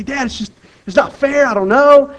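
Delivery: lightly voiced son protesting to his dad; whiny kid. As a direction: whine that it's not fair.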